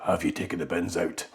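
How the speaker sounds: low, soft murmured chore question; mock whisper. Husband whispering to his wife, low and soft. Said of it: "I'm whispering in my wife's ear".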